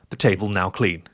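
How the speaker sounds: plain reading voice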